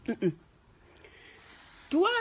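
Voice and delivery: normal talking voice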